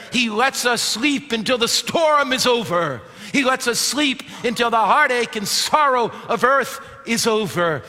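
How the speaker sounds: preaching at full force. Acted. Pastor preaching loudly to his congregation about death.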